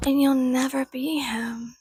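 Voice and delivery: slow taunting whisper. Acted. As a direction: breathe it out as a cruel secret. Taunting trolls about dead hero.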